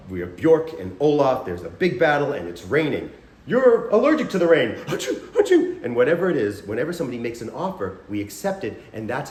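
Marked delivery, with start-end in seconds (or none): 3.5-4.7 loud, high-pitched mock announcement; hammed-up stage line. Improviser adding to the brothers' rain scene, hammed-up exclamation. 4.9-5.8 two high-pitched mock sneezes; fake sneezes. Improviser allergic to the rain, hammed-up fake sneezes.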